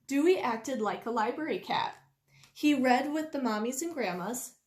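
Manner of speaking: usual speaking voice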